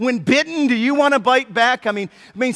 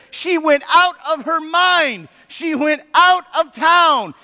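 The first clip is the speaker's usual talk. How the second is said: slow, higher, leaning on out; stretched out; 6 semitones higher, slower than usual. Real, not acted. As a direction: drag the line out slowly. Wordplay on going out.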